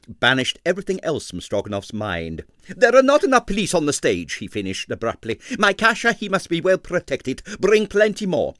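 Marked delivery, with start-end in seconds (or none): 2.7-8.5 high, loud urgent orders; fast at first, then fussing over Kasia; commanding impresario. A narrator's reading. Stroganoff orders more police.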